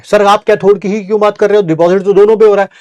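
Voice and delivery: mock a student catching the teacher out; hammed-up questioning pupil; 1 student objecting.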